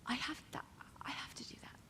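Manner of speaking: hushed, breathless whisper; whispered vow. Retold or imitated. Girl in the audience vowing to dance, whispered. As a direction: whisper it like a secret promise. Retelling her tearful vow.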